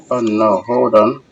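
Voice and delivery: usual speaking voice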